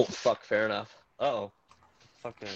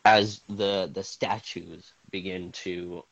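dramatic scene-setting narration; game master narration; 3 semitones lower, 4 dB louder than usual. Acted. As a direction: set the scene like a storyteller. GM narrates the ice cave.

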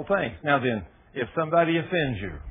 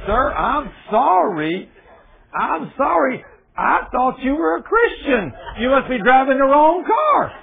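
mock sorry; 7 semitones higher, 3 dB louder, slower than usual. Mock apology to driver.

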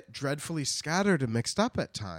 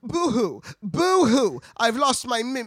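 high, loud hammed-up boo-hoo sobbing; mock crying kangaroo; 11 semitones higher, 7 dB louder than usual. Kangaroo sobs to Dorothy about lost mittens, hammed-up high wail.

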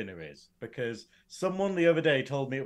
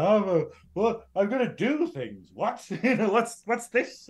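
mock confusion; 7 semitones higher, 3 dB louder than usual. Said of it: "you're just like".

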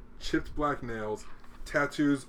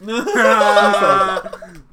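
laughing; 7 semitones higher, 14 dB louder, much slower than usual. Real, not acted. Punchline of army story.

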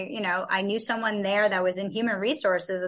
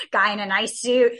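ordinary delivery

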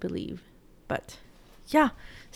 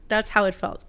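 ordinary talk

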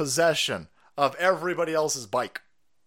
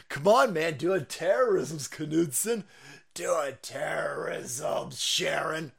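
mock FBI agent; much slower than usual. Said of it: "F B I agents currently watching this".

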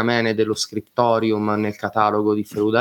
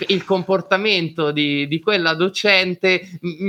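ordinary delivery

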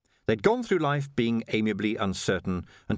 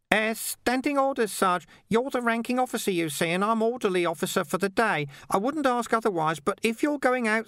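much higher, hesitant and faltering; faltering Ping; 12 semitones higher than usual. Acted. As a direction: trail off unsure. Ping explains orders.

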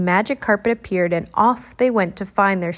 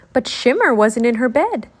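ordinary delivery

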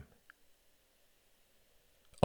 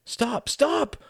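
very high, urgent cry; shouted protest; 3 dB louder than usual. A narrator's reading. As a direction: cry out high to make them stop. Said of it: "shouted Tom".

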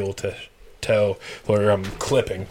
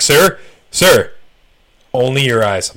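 louder, firm cop warning; mock stern officer, louder; 3 semitones higher, 9 dB louder than usual. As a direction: bark it like a cop correcting you. Voicing the cop's correction.

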